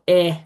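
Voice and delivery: strong "eh", vowel of bed. Shown as a demonstration.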